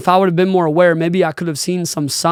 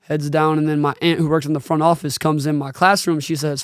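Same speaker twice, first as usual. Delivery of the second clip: usual speaking voice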